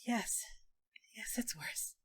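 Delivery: very soft, tired admission; almost no voice, drained; faint and weak. Acted. Weak Maeveris admits.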